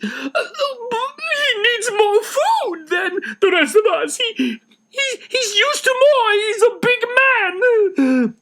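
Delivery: high, slow, crying protective plea; tearful defense. Acted. Missus Van Dan shielding her husband, tearful plea. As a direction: defend your husband through sobs. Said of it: "going to Mister Van Dan protectively".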